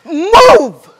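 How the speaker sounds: a sharp loud yell of a single word; screaming at him. Acted. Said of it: "You're screaming now".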